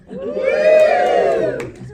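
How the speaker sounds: high loud shout; excited shout to the audience; loud cheer. Real, not acted. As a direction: shout it back at the cheering crowd. Reacts to crowd cheering.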